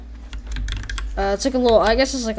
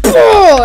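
loud, high "bah" as sound effect; mock attack noise, blurted out; imitated throwing noise; 10 semitones higher, 12 dB louder than usual. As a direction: burst it out like a cartoon blow. Imitating the fishing rod throw.

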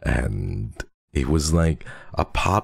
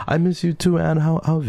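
cutesy coo; 13 semitones higher than usual. Acted. Cooing back to chat.

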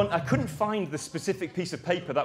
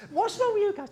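much higher imitated voice of Danny; Danny's way of talking. Account man asking the stuck team, high imitation. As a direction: repeat Danny's high sing-song question. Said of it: "That's kind of how he spoke".

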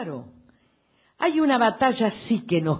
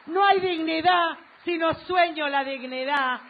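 higher pitched, insistent refrain; fervent rally oratory; 5 semitones higher, 5 dB louder than usual. Real, not acted. Politician telling supporters there is no country without dreams, fervent oratory. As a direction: build a rhythmic list for the crowd.

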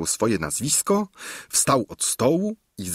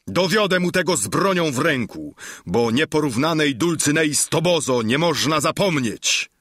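shouting at full throat; 6 semitones higher than usual. A narrator's reading. Don Quixote shouts through the partition, indignant. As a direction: roar out your honour's defence. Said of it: "zawołał na całe gardło".

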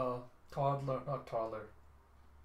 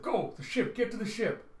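high-pitched, raised-voice order; high, urgent command; urgent shout; 9 semitones higher, 5 dB louder than usual. Acted. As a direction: yell to flee for safety. Urgent shout while fleeing vampires.

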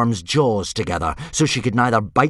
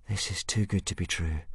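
soft, private aside; talking to himself; 2 semitones lower, 10 dB quieter than usual. A narrator's reading. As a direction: murmur it to yourself. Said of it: "Hiccup said to himself".

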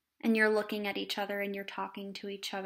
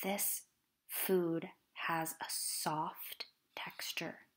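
mindful inner voice. Shown as a demonstration. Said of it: "in my head".

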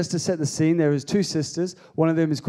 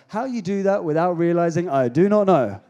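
ordinary talk

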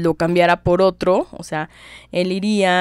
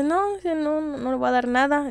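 indignant refusal; 9 semitones higher than usual. Retold or imitated. Concha refusing Miramón.

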